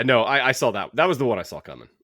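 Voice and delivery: ordinary talk, nothing marked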